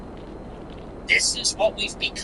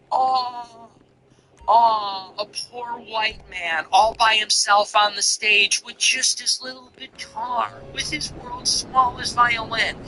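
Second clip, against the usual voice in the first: mock pity; 5 semitones higher than usual. Mocking a lone singer.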